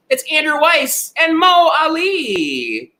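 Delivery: high, drawn-out winner announcement; announcer reveal. Real, not acted. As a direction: sing out the winners' names, stretching the last one.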